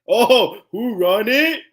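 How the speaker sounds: high, loud victory cry; triumphant brag. Retold or imitated. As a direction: crow about winning like a champion. Triumphant boast at the hilltop.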